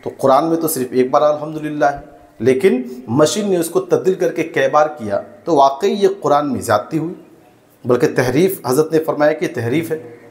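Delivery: normal talking voice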